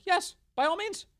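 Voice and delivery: high, mock-welcoming official; hammed-up cheerful host; mock governor voice. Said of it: "these Republican governors were like".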